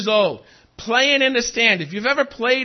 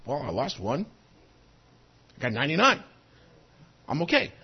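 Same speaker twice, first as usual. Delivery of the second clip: a much lower, softer, offhand shepherd shrugging off the loss; 8 semitones lower, 6 dB quieter, faster than usual. Retold or imitated. Preacher imitating a careless shepherd, low and offhand. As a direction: mutter it like a shepherd who's fine with ninety-nine. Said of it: "a real shepherd might have thought".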